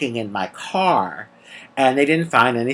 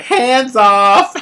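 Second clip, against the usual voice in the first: high, louder hammed-up exclamation; mock alarm; 10 semitones higher, 5 dB louder than usual. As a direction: exaggerate everyone backing away in a raised pitch. Officers recoiling, acted for comic effect.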